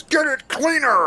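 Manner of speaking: high, hammed-up pitchman yell; loud mock TV-ad bellow; yelled ad catchphrase. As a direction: holler it like an over-the-top detergent commercial. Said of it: "Just yelling at people".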